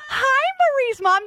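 high, laughing, thrilled hello; excited laughing greeting. Real, not acted. Host greets friend's mother.

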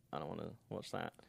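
low, soft aside; muttered reaction. Retold or imitated. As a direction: toss it off quietly under your breath. Quoting own dismissive reaction as joke.

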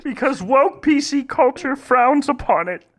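mock the pundit ranting to Nick; hammed-up Jordan Peterson rant; Jordan Peterson impression. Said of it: "I start speaking like Jordan Peterson".

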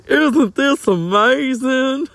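high, slow, wide-eyed gushing; Huell Hauser. Retold or imitated. Vlogger imitating a TV host, high slow gushing. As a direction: gush with wonder, drawn out high. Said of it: "I could be like Huell Hauser".

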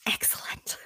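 a gleeful whispered gloat; hushed triumphant whisper. Real, not acted. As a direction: whisper it like a pleased villain.

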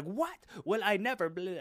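high-pitched scandalized outburst; hammed-up outrage, first word shooting way up; mock freak-out. Restaurant host losing it at a caller, scandalized freak-out. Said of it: "the dude really starts to freak out".

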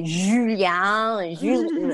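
"Julien" drawn out in overdone French; botched French pronunciation. Shown as a demonstration. Attempting his name, "Julien" in laboured French. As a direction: stretch "Julien" with a clumsy French J and ending. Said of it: "horrible at pronouncing his name the French way".

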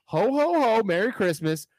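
high jolly ho ho ho; a quick Santa call. Shown as a demonstration. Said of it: "As Santa".

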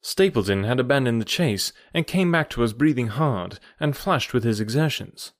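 usual speaking voice

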